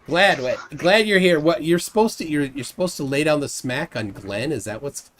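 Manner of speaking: ordinary talk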